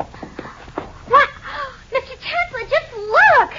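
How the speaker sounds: high gasping alarm, then a cry; shocked gasp. Acted. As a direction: gasp and cry out at the wrecked room. Discovers ransacked room.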